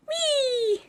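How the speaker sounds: high happy whee; gleeful whee. Real, not acted. Dreaming of Disneyland, high gleeful whee. Joyful exclamation.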